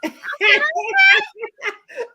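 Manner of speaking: high, loud burst of laughter. Real, not acted. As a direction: crack up out loud. Laughing at the woo demo.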